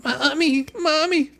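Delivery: mocking whiny wail with a fake sob; mock crybaby. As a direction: whimper like a teased child. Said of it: "just sort of like you going".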